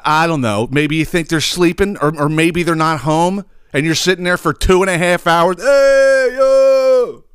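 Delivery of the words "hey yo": high, drawn-out holler; mock screaming at window. Man on the sidewalk calls up to the balcony, high mock holler. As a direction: imitate a guy hollering up to a balcony. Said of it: "Fucking screaming at the window".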